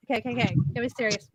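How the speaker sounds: usual speaking voice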